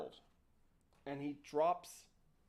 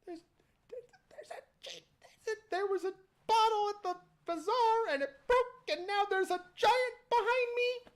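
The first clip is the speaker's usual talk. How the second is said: put-on panicked boy; 14 semitones higher than usual. Boy explains the giant behind him to dad, panicked. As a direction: stammer it out in a terrified high voice. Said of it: "the boy explains".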